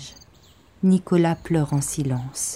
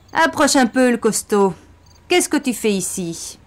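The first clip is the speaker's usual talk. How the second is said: mocking taunt; 6 semitones higher than usual. Gang leader taunts Bastien.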